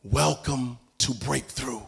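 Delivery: slow low voice of God. Retold or imitated. God announcing breakthrough to him, low and slow. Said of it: "God says to me".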